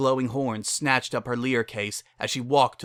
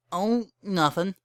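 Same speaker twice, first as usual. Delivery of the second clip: high, slow, evasive pony voice; evasive Bon Bon; 8 semitones higher than usual. Acted. Bon Bon dodging Lyra's question, high and slow. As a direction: brush it off, hiding something. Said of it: "her voice trailed off".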